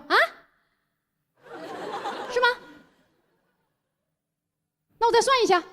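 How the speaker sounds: flustered shop boss. Retold or imitated. Boss stalling a customer who questions the bill, flustered. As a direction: play the boss caught out.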